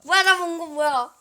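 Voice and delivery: yelling in disbelief; loud stunned outburst; shocked shout. Real, not acted. Shocked shout after a play.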